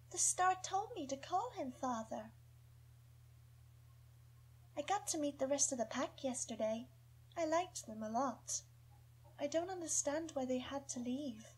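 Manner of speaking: put-on high, soft dreamy girl's voice; sweet young girl. Young Jala talking of howling at stars, sweet. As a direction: tell it sweetly, like a secret. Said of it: "She has a sweet young voice".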